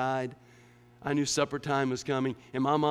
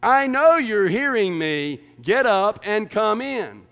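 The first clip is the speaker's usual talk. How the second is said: higher, louder, fed-up mother calling her son; indignant mom; 9 semitones higher, 13 dB louder, slower than usual. Retold or imitated. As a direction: scold the kid who won't look up. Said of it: "a little more indignant attitude".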